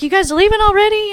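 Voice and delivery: high, lightly voiced teasing star; playful old man. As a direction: coax them to stay. Star teasing departing fans.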